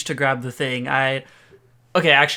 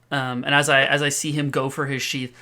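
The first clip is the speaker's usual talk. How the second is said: normal talking voice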